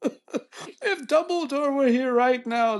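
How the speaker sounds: very high, drawn-out mock British cry; grand, slow British impression, squeaking up high; British accent. Retelling the debater's Dumbledore line, mock British indignation. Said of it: "In a British accent".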